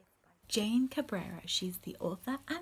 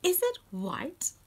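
high questioning; 8 semitones higher, 5 dB louder than usual. Acted. Reading questions to children.

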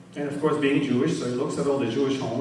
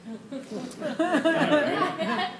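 high laugh at his own joke; 12 semitones higher than usual. Real, not acted. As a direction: crack up at the punchline.

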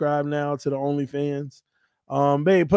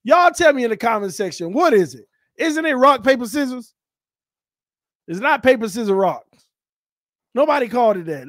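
ordinary delivery

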